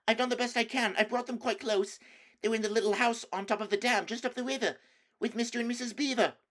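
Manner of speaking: put-on high, fast pleading boy's voice; anxious boy. Edmund excusing himself to the witch, anxious. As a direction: hurry to justify yourself.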